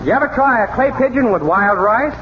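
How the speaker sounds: normal talking voice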